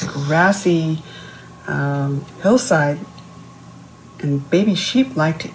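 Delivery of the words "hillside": usual speaking voice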